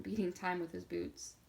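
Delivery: ordinary delivery, nothing marked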